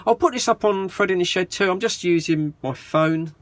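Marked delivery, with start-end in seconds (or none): none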